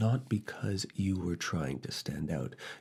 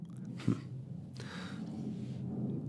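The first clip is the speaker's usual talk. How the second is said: breathy soft laugh; 7 dB quieter than usual. Acted. Laugh between words.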